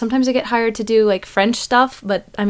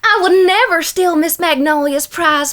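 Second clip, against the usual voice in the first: higher, pleading Southern belle accent; 6 semitones higher than usual. Shown as a demonstration. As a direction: plead innocence to the sheriff, Southern style. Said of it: "a southern states?".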